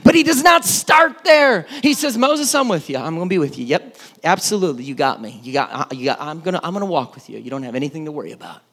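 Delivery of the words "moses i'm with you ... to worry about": lower, softer, quick offhand reassurance; lightly voiced laid-back God; casual God voice. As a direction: brush it off like God saying sure, sure.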